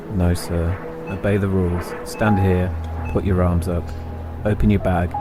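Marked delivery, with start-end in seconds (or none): none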